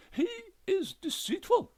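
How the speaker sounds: very high, loud accusation; accusing ghost wife. A narrator's reading. Ghost wife accuses her husband, very high and loud. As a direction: spit out the charge, pitched high.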